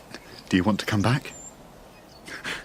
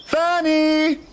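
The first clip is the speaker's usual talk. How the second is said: calling out; 9 semitones higher than usual. Acted. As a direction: sing out her pet name to find her. Father calling daughter.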